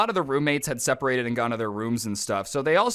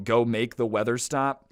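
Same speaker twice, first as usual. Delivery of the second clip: plain reading voice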